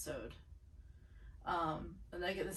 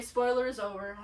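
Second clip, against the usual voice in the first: ordinary talk, nothing marked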